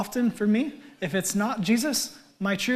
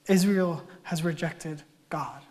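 ordinary talk, nothing marked

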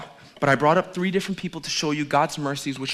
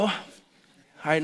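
usual speaking voice

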